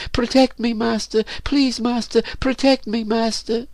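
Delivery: high falsetto, frightened begging; put-on high voice of a terrified girl; pleading slave girl. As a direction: plead for protection in a thin high voice. Slave begs master for protection.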